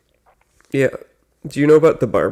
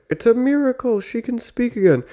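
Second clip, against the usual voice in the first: mock amazement; 11 semitones higher, 3 dB quieter than usual. Retelling the family's reaction to Helen, mock amazement. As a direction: imitate a family marveling at a miracle.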